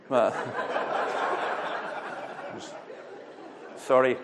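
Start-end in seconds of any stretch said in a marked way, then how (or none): 0.4-3.1 a high, breathless burst of laughter; real laugh. Real, not acted. Preacher laughing at his own toilet joke. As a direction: crack up in spite of himself.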